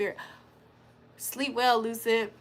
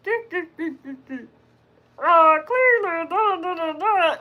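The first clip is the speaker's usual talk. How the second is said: Cleveland Brown Junior; 4 semitones higher than usual. Acted. Streamer mimics a cartoon character for chat, high silly impression. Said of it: "why does Cleveland Brown Junior talk like".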